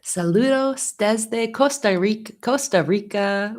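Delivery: an earnest but imperfect Spanish accent; awkward Spanish accent. Real, not acted. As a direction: greet in Spanish with a clumsy, non-native accent. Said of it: "Excuse my awful Spanish accent".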